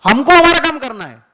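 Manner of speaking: booming declaration; full-force preaching; emphatic loud. Real, not acted. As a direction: hammer home the duty loudly. Preacher stresses duty of disciples.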